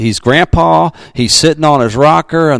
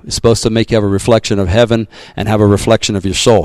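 normal talking voice

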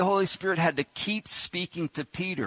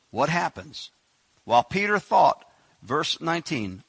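ordinary talk, nothing marked

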